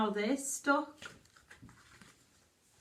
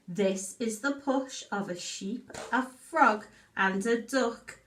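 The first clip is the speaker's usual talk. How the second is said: put-on reading a story to children; 2 semitones lower, slower than usual. Reads picture book aloud.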